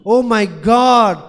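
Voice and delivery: ordinary delivery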